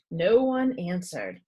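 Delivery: usual speaking voice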